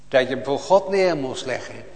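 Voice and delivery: ordinary delivery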